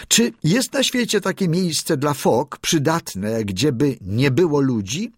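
high, pressing question; lightly voiced high young seal; young seal asking. A narrator's reading. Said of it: "spytał od razu".

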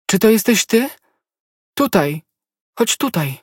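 high, loud call to her beloved. A narrator's reading. Konstancja calls out to the young man, loud. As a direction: call out to him, high and eager. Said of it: "wołała".

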